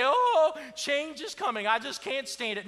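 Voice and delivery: high, loud whining voice, hammed-up; mock whiny complaint. Preacher mocking churchgoers who fear change, whining. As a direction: whine like someone dreading change.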